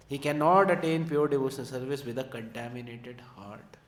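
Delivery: very slow, weighty reading aloud of commentary; slow scripture reading. Acted. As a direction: read every word out slowly and carefully. Reads purport in class.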